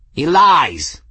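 loud, high-pitched accusing screech; sudden high outburst. A narrator's reading. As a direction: screech it out as an outraged cat. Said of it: "Nin screeched".

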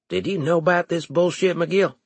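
high, fast accusing question; hard questioning. Acted. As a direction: press him for the truth. Leeson confronting McGill.

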